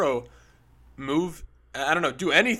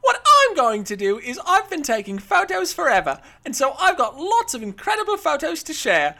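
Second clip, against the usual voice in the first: put-on higher, louder theatrical Australian accent, dripping with luxury; great Australian accent; 6 semitones higher, 4 dB louder than usual. Host reads a star's post in her Australian accent. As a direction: read it as a grand Australian showgirl, all darlings and sequins. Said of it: "she says".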